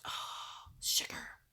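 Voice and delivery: hushed, whispered mock curse; mad muttering. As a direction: let it out under your breath, exasperated. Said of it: "I was mad".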